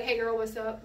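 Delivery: loose, easy slangy greeting; casual with friends. Acted. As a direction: toss it off to a girlfriend. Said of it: "when you're with your friends".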